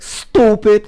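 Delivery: mocking shout "Stupid"; stooopid. Shown as a demonstration. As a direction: stretch "Stupid" high and loud. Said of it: "they say it's like".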